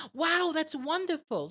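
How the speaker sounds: high, bright excited rise; excited rising tone. Shown as a demonstration. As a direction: sound thrilled, let the pitch climb high. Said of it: "my intonation is rising because I'm excited".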